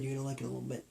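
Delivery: usual speaking voice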